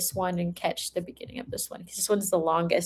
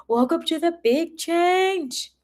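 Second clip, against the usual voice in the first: drawn-out, sing-song welcome, pitched up; grand welcome; 8 semitones higher, slower than usual. Acted. Reader welcoming the viewer to their big change, playfully grand. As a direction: announce it like a game-show host, slowly. Tarot reading, greeting a change.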